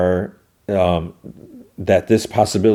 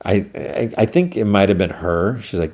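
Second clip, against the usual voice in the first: usual speaking voice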